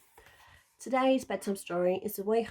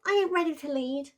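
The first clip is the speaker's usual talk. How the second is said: high, louder boastful pup; eager pup; 7 semitones higher, 4 dB louder than usual. A narrator's reading. Wilf telling the pack he can lead, lightly voiced. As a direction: insist like a small wolf wanting to lead. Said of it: "shouted Wilf".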